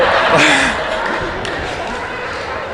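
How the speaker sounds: a long airy laugh that breaks up the story. Real, not acted. Coach cracking up while telling the crowd about Shaniqua. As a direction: laugh it off, unable to go on yet.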